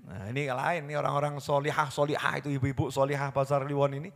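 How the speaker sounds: normal talking voice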